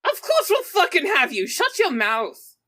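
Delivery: ordinary delivery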